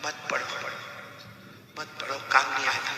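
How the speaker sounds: very soft, weakened voice; dying student's plea. Retold or imitated. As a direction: plead faintly with your teacher. Dying student begs teacher. (मरते शागिर्द की धीमी आवाज़)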